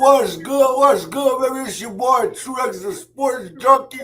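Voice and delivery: talking around a grill in the mouth; words thick from a mouthpiece; mouth full. Real, not acted. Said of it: "I know I'm talking a little funny".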